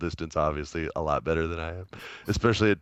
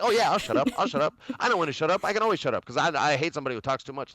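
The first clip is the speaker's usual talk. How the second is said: higher, babbling cartoon sidekick; imitated little dog, higher and over-eager; chattering little dog; 6 semitones higher, much faster than usual. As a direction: babble on eagerly while promising to shut up. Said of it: "He's like".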